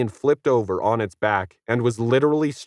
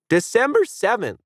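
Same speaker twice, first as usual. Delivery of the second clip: much higher, louder bratty interjection; added loudly; 14 semitones higher than usual. A narrator's reading. Kid correcting the guide, loud. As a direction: blurt it out over her. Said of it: "Oumuamua added loudly".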